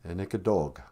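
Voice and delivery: ordinary talk, nothing marked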